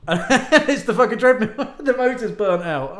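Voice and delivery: high, loud laughing reveal. Real, not acted. Laughing while telling listeners the treadmill broke. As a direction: laugh through the punchline.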